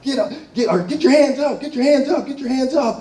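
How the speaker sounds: high barked commands through a bullhorn; cop shouting orders. Retold or imitated. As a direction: imitate police yelling get your hands up. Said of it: "they're screaming at me".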